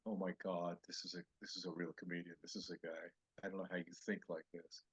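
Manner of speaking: hushed retold amazement; private awe. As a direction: murmur it to yourself, humbled. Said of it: "I'm sitting there going".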